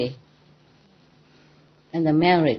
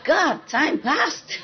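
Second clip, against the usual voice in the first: laughing wonder; 12 semitones higher, 4 dB quieter, much slower than usual. Real, not acted. Marveling son is fourteen.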